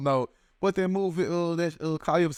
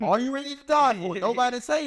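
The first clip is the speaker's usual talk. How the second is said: challenging question; 8 semitones higher than usual. Acted. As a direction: put the question to them hard.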